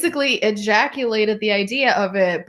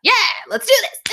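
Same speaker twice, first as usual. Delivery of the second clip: excited; 11 semitones higher, 3 dB louder than usual. Retold or imitated. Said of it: "And she's like".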